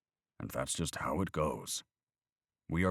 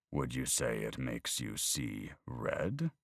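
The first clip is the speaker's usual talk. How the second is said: slow, softer teasing question; sly punchline; 3 semitones lower, 4 dB quieter, slower than usual. Retold or imitated. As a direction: savour the pun as you ask it.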